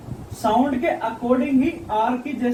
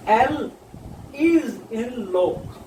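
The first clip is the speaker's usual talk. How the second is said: very slow, each word stretched out; slow demo; much slower than usual. Shown as a demonstration. Teacher drawing out an example word for students, very slow. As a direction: spell out the example slowly for students.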